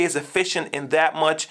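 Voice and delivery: ordinary delivery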